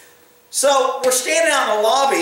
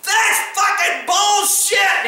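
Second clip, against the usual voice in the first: higher, slow, outraged shout; angry shout; 9 semitones higher, much slower than usual. Retold or imitated. Angry father yelling at the officer in the lobby, outraged shout. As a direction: let the words burst out slow and furious. Enraged man throwing chairs again.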